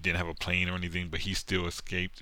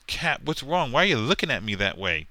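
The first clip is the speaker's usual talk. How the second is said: higher, wide-eyed sidekick voice; lightly voiced innocent sidekick; 6 semitones higher, 4 dB louder than usual. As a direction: play dumb in a higher voice.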